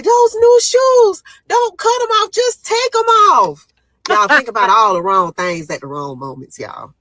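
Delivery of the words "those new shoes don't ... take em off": stressed plea. Retold or imitated. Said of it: "I'm stressing to the lady".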